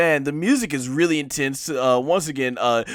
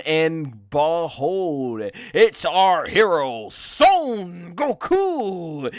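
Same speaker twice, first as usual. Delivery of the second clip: 1 announcer reveal; 6 dB louder, much slower than usual. Real, not acted. Announcing the hero's arrival.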